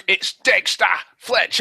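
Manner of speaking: hammed-up cockney accent; mock cockney. Host correcting co-host on the presenter, mock cockney accent. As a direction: correct him in exaggerated cockney.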